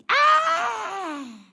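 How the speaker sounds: long high loud shriek; demon's departing scream. Retold or imitated. Demon leaving the woman with a cry, high loud scream. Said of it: "com um grito assim".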